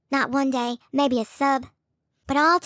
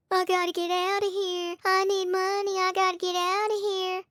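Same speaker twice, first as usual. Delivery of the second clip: mocking whine; 6 semitones higher than usual. Mocking his pleas.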